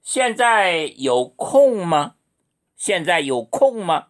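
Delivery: very slow, each syllable separate; slow classroom question; slow model question. Shown as a demonstration. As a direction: drill the question slowly. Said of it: "이렇게 읽으세요".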